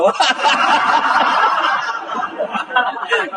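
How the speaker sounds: long high cackling laugh; high unvoiced giggling fit; high laughter. Real, not acted. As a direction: burst out laughing at the joke. Preacher laughs at his story.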